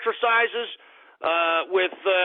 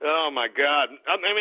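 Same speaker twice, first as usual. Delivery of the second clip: normal talking voice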